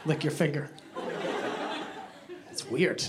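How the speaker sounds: low, soft, puzzled exchange; hushed confusion. Acted. Diners in the dark puzzling over a spill, hushed confusion. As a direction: keep it down, unsure and puzzled.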